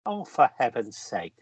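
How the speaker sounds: breathy whispered brush-off; whispered aside. Acted. Danvers telling Mary to drop the formality, whispered. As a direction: wave off the title in a whisper.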